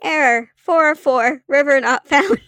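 robot voice announcing an error; glitching robot. Acted. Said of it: "it comes up with".

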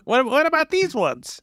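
put-on squeaky kid begging at a candy store. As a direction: play an eager little boy asking for candy. Child role in improv skit.

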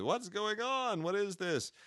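high, slow puzzled voicing; bewildered family. Family waking to goo, asking in high bewilderment. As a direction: play the baffled family waking up.

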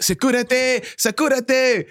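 high mock call for security; teacher calling security, mock high; teacher original call. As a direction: cry out for security. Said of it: "he'd be like".

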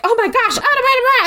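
high, babbling retold alarm; hammed-up frantic fluster; mock panic. Retold panic at bleeding girl.

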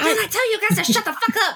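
high, fast, breaking into laughter; laughing outburst. Real, not acted. Remy's player snapping at the table, laughing hard. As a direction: blurt it while cracking up.

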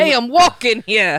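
loud New York tough-guy line, voice pushed away from own. Pretend. Friend retries the New York accent after coaching, loud tough New Yorker. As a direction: bark it like a New York cabbie. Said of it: "sound like an asshole from New York".

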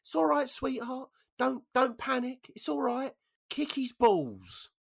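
put-on very high old-lady voice; squeaky old woman calling out comfort; little old dear. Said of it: "this little old deer's head pops out".

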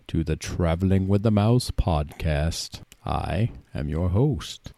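drawn-out spooky welcome to foolish mortals; Ghost Host voice. Acted. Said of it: "only I'm not a ghost host".

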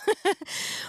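airy silent laugh, high; breathless helpless laugh. Real, not acted. Cohost overwhelmed, laughs helplessly.

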